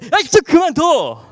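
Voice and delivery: high, loud, slow retold snap at mom; irritated yell. 잔소리하는 엄마에게 짜증 내며 소리치는 아들, high loud irritated yell. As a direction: bark it out like a fed-up son. Snapping at mother on phone.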